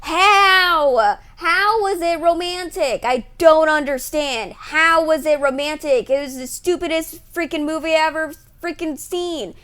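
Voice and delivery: loud, incredulous exasperation; exasperated. Retold or imitated. Vlogger retorting to fans, loud exasperated disbelief. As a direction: demand how, fed up. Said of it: "And I'm like".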